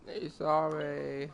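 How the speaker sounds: usual speaking voice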